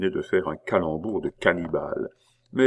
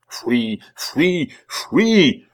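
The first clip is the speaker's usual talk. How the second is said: three cries climbing higher and louder; stage-tragedy command, each cry more intense; tragic; 8 semitones higher, 7 dB louder, much slower than usual. A narrator's reading. Abbé advancing a step with each word, tragic. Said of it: "prononcé d'une façon tragique".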